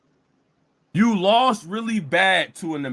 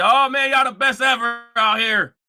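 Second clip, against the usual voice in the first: mocking gush; 4 semitones higher, 3 dB louder than usual. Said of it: "and say".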